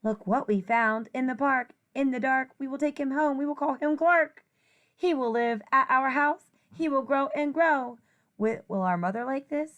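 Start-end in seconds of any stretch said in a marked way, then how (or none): none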